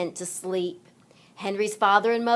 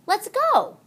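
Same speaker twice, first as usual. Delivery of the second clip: much higher, bright call to set off; cheerful call; 13 semitones higher, 4 dB louder than usual. Acted. Mother starting the hike, cheery call. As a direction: call it out eagerly. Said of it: "Henry's mother said".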